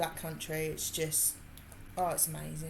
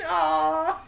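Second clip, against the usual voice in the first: normal talking voice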